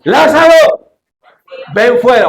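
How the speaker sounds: high commanding shout of Lázaro; Jesus calling Lázaro out of the tomb with a great cry; loud cry. Acted. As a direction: call him out of the tomb. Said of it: "clamó a gran voz".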